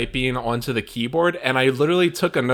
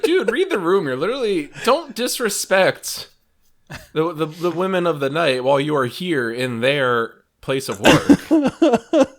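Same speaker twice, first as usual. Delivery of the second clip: mock scolding; 6 semitones higher, 4 dB louder, slower than usual. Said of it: "I'm just like".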